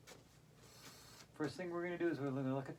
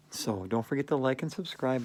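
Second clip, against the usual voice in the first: ordinary delivery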